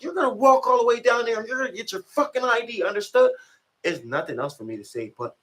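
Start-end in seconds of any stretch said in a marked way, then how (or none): 0.0-3.3 high-pitched mock officer's order; fake yell. Officer ordering inmate to fetch ID, mock yelling. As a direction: lay down the order in a raised, pitched-up voice. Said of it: "He trying to fake yell at me and shit".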